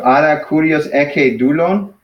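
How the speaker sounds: slow, with "Dulon" rising as a question; questioning tune. Shown as a demonstration. Teacher models a question for the student, questioning rise on "Dulon". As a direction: ask it, lifting the end on "Dulon". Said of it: "So that's a question".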